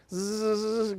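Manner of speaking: short voiced buzz; imitated buzz. Shown as a demonstration. Teacher hunting for a letter on the board, brief buzz.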